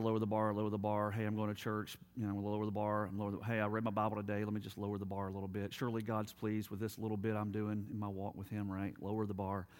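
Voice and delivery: low, quick monotone mumbled rationalizing; casual self-excusing. Acted. Churchgoer excusing himself for lowering the bar, offhand and monotone. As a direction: rattle off excuses under your breath, low and even. Acting out someone compromising with God.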